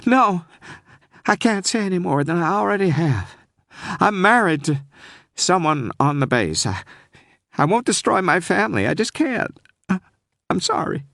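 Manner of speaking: pleading and near tears; panicked Southern woman. Acted. Scared caller refusing the detective, panicky Southern voice. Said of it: "the caller was frightened, maybe close to panic".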